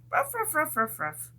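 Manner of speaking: high playful barking; dog barks. Pretend. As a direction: bark like a small dog.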